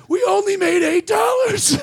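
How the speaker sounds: high, pleading imitation whine; mock tearful. Promoter pleading poverty to the comic, mock tearful whine. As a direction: protest it high, like a weepy man. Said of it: "and starts crying".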